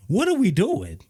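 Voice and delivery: high, incredulous what are we doing; exasperated. Real, not acted. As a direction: throw up your hands in disbelief. Exasperated about [name]'s accents.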